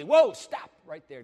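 loud halting "whoa"; sudden interruption. Acted. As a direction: cut in loudly to stop someone mid-thought. God cuts off Abraham's thought, acted.

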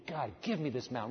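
quiet self-prayer; soft private prayer. Shown as a demonstration. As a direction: murmur it to yourself. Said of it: "say the words to yourself".

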